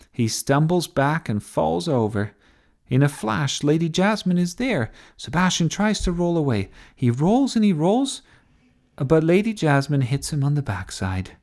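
high, excited play-by-play; TV commentary. A narrator's reading. Sophie calling Sebastian's tumble for TV, excited commentary. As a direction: call the action as it unfolds. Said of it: "doing the commentary for T V".

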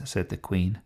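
ordinary delivery

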